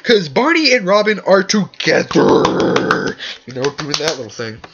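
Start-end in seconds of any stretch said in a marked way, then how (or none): 0.0-3.2 loud, high, slow retort. Retold or imitated. Answering Victoria on the TV, a loud high retort. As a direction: shoot it back at her. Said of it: "I was like".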